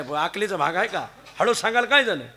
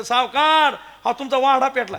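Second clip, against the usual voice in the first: alarmed call; 5 semitones higher, 7 dB louder than usual. Retold or imitated. Servant announcing the fire.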